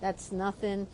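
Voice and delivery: soft, offhand brush-off; dismissive. Retold or imitated. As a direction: wave it off under the breath. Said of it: "and I'm like".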